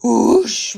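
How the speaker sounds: long, drawn-out whoosh; wind whoosh. A narrator's reading. Storyteller voicing the wind at the door, long whoosh. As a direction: blow it like rushing wind. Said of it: "went the wind".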